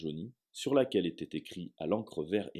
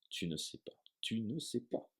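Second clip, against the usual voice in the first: much softer stunned repetition; 3 semitones higher, 8 dB quieter than usual. Acted. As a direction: murmur it in disbelief. Hagrid stunned, repeating.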